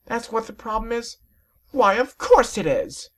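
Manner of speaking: very high, slow refined lady voice; drawn-out fashionable lady; high, affected voice; prissy Rarity. Acted. Rarity answers Rainbow Dash.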